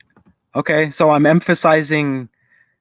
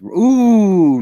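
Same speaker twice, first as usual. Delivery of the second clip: excited ooh; 6 semitones higher, 6 dB louder than usual. Real, not acted. Reacting to blue eyes.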